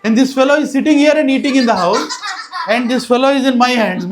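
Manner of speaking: high, loud bewildered outburst; flabbergasted. Retold or imitated. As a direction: pile up the facts in disbelief. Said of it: "This gopi is flabbergasted".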